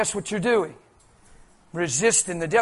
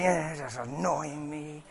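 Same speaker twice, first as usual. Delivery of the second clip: lower, much softer, slow sulk; the ignored kid sulking, lower, soft and slow; mock sulky mumble; 6 semitones lower, 10 dB quieter, slower than usual. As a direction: mope it out under your breath. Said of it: "It's frustrating".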